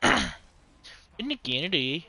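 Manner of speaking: slow, drawn-out mock Canadian boast. Gamer joking about barely stepping over the border, mock Canadian. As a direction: play up a Canadian accent, stretching the words. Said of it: "step over and be like".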